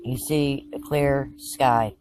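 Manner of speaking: hushed, measured meditation voice; calm narration with lots of space between phrases; guided visualization. Acted. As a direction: guide them through the scene phrase by phrase, leaving long pauses.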